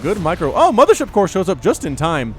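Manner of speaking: game caster getting worked up; excited play-by-play. Real, not acted. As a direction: call the last-second save with mounting excitement. Caster calls sudden attack and rescue.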